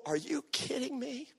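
high, hushed disbelief; acted quiet astonishment; incredulous. Incredulous at speaking in public.

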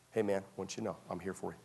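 soft, quick, comforting pastor; reassuring pastor. Shown as a demonstration. Pastor showing how he comforts a fallen member, softly. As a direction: reassure him close and gently. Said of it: "Here's what I do".

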